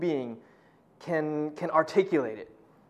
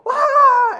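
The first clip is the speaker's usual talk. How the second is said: a sudden high, loud wail of fright; the girl fleeing the shower in a fake scream; mock terrified scream; 13 dB louder than usual. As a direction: let out a hammed-up panicked shriek. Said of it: "she ran out screaming".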